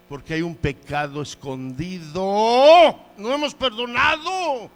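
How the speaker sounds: swelling from normal to a loud, drawn-out shout; impassioned, rising pulpit cry; preaching cry. Real, not acted. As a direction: cry out the fault, stretching the word. Preacher climaxes on hidden sin.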